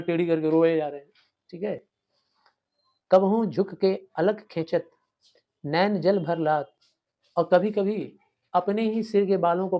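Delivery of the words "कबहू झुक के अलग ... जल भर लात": verse recitation. Shown as a demonstration. Teacher recites poem lines.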